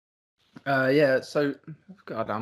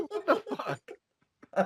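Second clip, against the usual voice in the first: laughing disbelief; 5 dB quieter, much faster than usual. Retold or imitated. Shocked reaction retold, laughing.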